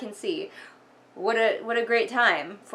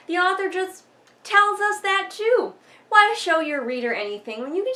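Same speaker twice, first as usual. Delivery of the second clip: much higher and louder, slowing on the last words; exasperated; 9 semitones higher, 6 dB louder than usual. Real, not acted. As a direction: throw your hands up at the author. Exasperated rant at the author.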